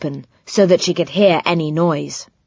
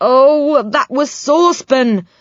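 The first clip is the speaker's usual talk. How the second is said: groaning; 7 semitones higher, 3 dB louder than usual. A narrator's reading. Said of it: "groaned Joe".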